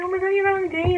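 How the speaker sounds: high-pitched mock-excited squeal of friends; imitating excited friends. Friends' reaction to her date news.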